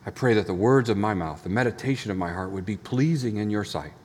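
low, soft, reverent prayer; hushed, measured pastoral prayer. Real, not acted. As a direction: pray gently with long pauses between phrases. Leads the congregation in prayer.

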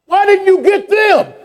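very high, slow, squeaky mock voice; high mocking squeak. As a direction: squeak it out like a startled kid. Mocking shocked friends' question.

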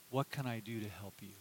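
very soft and low; tender offer. Retold or imitated. God of universe speaking to listener, tender and soft. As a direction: gently offer help.

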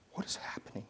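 hushed, amazed whisper. Pretend. Preacher marvels at the scene, in a whisper. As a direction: breathe it like a secret. Astonished aside in the story.

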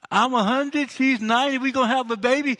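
high, loud disbelieving outburst; shouting in amazed disbelief; incredulous exclaiming. Acted. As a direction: blurt out the impossible news.

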